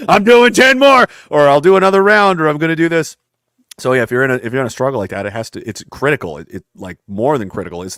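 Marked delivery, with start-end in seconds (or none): 0.1-3.2 pumped up. Retold or imitated. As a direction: fire yourself up to push on. Hyping himself in the sauna.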